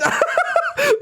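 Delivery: squealing laugh; breathless giggle fit; high-pitched laugh. Real, not acted. Reacting to absurd AI story.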